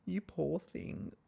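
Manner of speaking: soft, slow pity. Retold or imitated. Host pitying the waiter, softly and slowly. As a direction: murmur it with sympathy. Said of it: "And I'm like".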